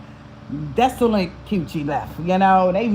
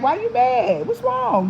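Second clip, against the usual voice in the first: mock sweet concern; 9 semitones higher, slower than usual. Woman teasing the leaving man with fake sweet concern. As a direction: fake innocent rising question. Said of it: "you be like".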